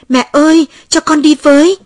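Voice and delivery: put-on high, loud child's cry after mother; wailing plea. A narrator's reading. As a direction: call out desperately, begging to come along. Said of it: "Tôi chạy theo mẹ gào khóc". (gào gọi mẹ van nài)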